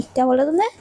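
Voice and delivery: ordinary delivery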